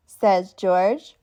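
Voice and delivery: usual speaking voice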